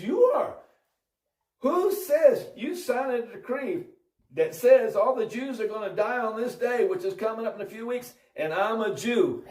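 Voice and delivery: high, insistent retold accusation; acted accusation. Esther confronts the king, retold.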